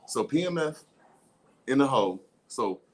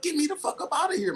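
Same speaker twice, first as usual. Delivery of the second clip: high pleading voice; 13 semitones higher than usual. Acted. Elephant begging from hole.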